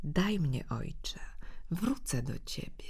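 put-on boy whispers to his father, secretive; whispering. A narrator's reading. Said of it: "szepce mu do ucha".

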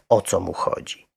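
plain reading voice

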